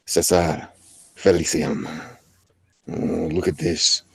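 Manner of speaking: put-on vague mash-up of Russian, Spanish or German accents; made-up foreign accent. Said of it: "I don't even know what that accent is".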